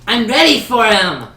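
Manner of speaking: high, drawn-out furious shouting; impatient princess. Acted. Princess yells across the hall for her bard, furious. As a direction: demand the bard like a spoiled royal.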